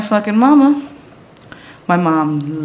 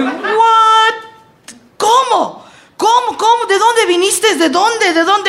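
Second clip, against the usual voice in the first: high, stammering disbelief; shocked panic; 13 semitones higher than usual. Retold or imitated. Husband caught by his wife, panicked high disbelief. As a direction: blurt out ¿Cómo? again and again, way up high.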